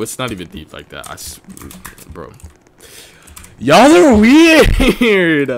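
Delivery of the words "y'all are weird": long drawn-out high yell; loud teasing protest. Real, not acted. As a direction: stretch it out loud and high at the chat. Calls out chat's comments.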